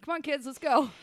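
high, loud call to the dogs; rallying the kids. Acted. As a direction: hustle the pack along loudly. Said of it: "I'm like".

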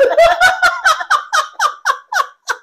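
real shrieking laughter; high squealing laugh. Real, not acted. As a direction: let the laugh soar high. Cracking up at the memory.

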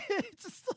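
high, loud cackling laugh; real burst of laughter. Real, not acted. Laughing at his own joke about Aquaman fans.

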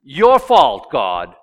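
your fault shouted at God; loud blaming. Retold or imitated. Adam accusing God, loud and bitter. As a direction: throw the fault back on God. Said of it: "emphasis added".

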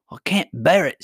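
exasperated. A narrator's reading. Queen protests at the singing.

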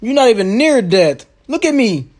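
loud, high hammed-up farewell; overacted death scene. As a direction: melodramatic cry to go on. Hammed-up dying hero.

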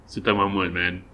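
words around a sip of water; talking while drinking water; while sipping a drink. Real, not acted. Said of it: "Sorry, I'm drinking water".

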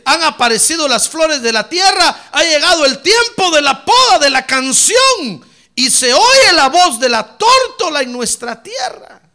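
high, very loud scripture proclamation; preaching shout. Real, not acted. Preacher reading verse twelve to the church, impassioned shout. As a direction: belt the verse out to the back pews.

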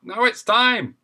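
high, loud call of closing time; landlord yelling time. Acted. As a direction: bellow last orders. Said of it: "yelled out".

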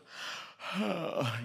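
high, strange drawn-out sigh of a lion; lion's yawning sigh. Retold or imitated. Preacher imitating the lion flopping down to sleep, long yawning sigh. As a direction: let out a lazy lion yawn. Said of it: "yawns and just flops".

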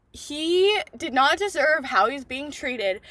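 normal talking voice

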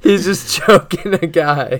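real laughter through the words; high, slowed by laughing. Real, not acted. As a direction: say it while cracking up. Laughing at the scene.

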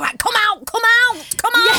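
yelling to come out; angry shout. Retold or imitated. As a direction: shout it, fed up and loud. Retold angry game hosts shouting.

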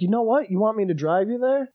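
a hint of the eager local driver; mild driver voice.

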